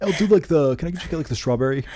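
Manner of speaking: hesitant, hammed-up bro order; bro impression of American. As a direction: imitate an American bro at the counter. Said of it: "I was being like a bro".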